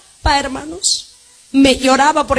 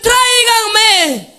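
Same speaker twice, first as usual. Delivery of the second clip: Jesus calling; 9 semitones higher, much slower than usual. Acted.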